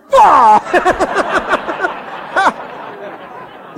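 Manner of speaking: loud high laugh breaking out; real hearty laugh; big high burst of laughter. Real, not acted. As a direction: laugh hard at the punchline. Big laugh at own story.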